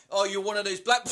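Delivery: high, loud taunt; cocky shouted jibe at a bear; hammed-up cocky challenge; mock chav challenge. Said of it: "mate's like".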